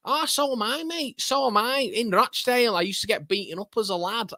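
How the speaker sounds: very high, hammed-up Mancunian; Mancunian lad. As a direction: imitate an eager Manc lad, pitched right up. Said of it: "that is what you call a Mancunian accent".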